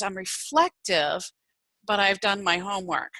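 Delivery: normal talking voice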